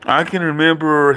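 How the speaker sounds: ordinary talk, nothing marked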